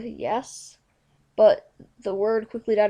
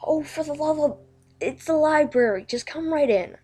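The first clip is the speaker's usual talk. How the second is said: raised, exasperated call through a door; higher, louder exasperated shout from another room; exasperated yell; 6 semitones higher, 5 dB louder than usual. Acted. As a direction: yell it from inside, fed up. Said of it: "a yell came from inside".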